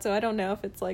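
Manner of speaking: ordinary talk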